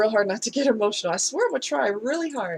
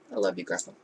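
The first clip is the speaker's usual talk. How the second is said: much lower and much softer, almost to oneself; tender hush; 9 dB quieter, much faster than usual. Real, not acted. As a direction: say it softly to Grandpa's memory. Tender words to late grandfather.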